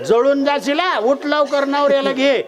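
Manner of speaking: high, pressing acted orders; alarmed neighbour urging escape; urgent warning. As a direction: hurry her away before she burns. Neighbour warns of burning.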